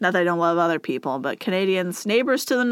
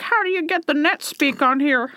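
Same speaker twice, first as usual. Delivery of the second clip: lightly voiced old grandma, a bit higher; a hint of a puzzled granny; 5 semitones higher than usual. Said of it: "Sounds like something my grandma would be like".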